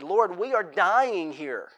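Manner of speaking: slow, strained cry to God; anguished plea. Retold or imitated. Psalmist pouring out anguish to the Lord, slow plea. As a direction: plead as if you are dying. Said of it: "there was an emotion involved with that".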